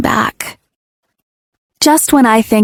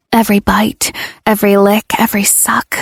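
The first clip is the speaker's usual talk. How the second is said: usual speaking voice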